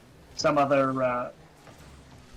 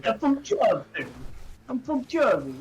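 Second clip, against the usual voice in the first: higher, slow, hammed-up New York accent; 5 semitones higher, slower than usual. Co-host boasting he's from Jersey in a mock New York accent. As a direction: lean hard into a mock New Jersey tough guy. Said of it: "the New York accent that you're trying to do".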